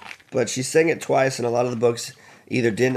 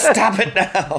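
laughing; 9 semitones higher, 6 dB louder, much slower than usual. Pretend. Mock order in earpiece.

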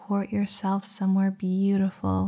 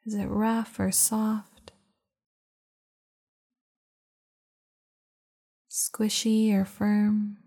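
guided meditation. Acted. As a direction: guide them into calm, unhurried. Leads a meditation.

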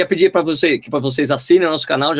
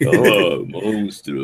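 low, drawn-out radio voice; radio announcer voice; 8 semitones lower, much slower than usual. Retold or imitated. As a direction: boom it like a DJ. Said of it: "voz de locutor de rádio".

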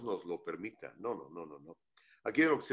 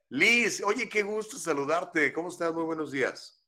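bienvenida aguda, fuerte y alargada; saludo efusivo; 8 semitones higher, 7 dB louder than usual. Real, not acted. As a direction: recibe a la invitada con mucho entusiasmo. Saluda con gusto a la invitada. (saludo entusiasta en voz alta)

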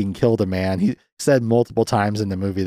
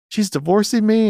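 high-pitched, wounded mock shock; retold indignant question, pitch way up; upset disbelief; 11 semitones higher than usual. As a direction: act out a man stunned by the news. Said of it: "he's upset".

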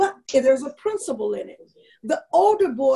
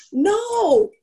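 higher, louder mock yell of an upset little boy; retold child scream; 7 semitones higher, 5 dB louder than usual. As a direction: imitate the kid's shriek of No. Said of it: "The little boy starts screaming".

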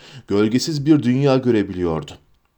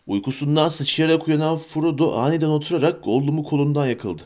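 ordinary delivery, nothing marked

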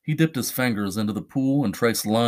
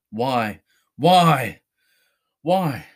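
anguished cries; much slower than usual. Acted. Charlie crying out alone on the floor, rising anguish. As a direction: plead to the empty room, voice climbing.

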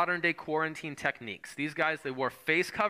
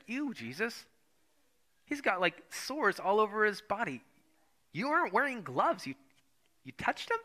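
incredulous, rising higher and higher; can't believe what you just saw; shocked onlooker; 5 semitones higher than usual. Retold or imitated. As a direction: disciple aghast that Jesus touched a leper. Bystander shocked Jesus touched leper.

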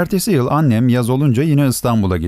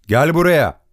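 higher-pitched, lightly called-out command; mild narrated shout; 6 semitones higher than usual. A narrator's reading. Father calls the stable hand over, mildly shouting. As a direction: summon someone with a restrained shout. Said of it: "Dada Ruh'a bağırdı".

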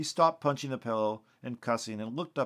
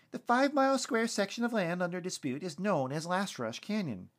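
higher-pitched local news report voice; TV field reporter; 8 semitones higher than usual. Acted. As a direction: deliver it as an on-the-scene reporter.

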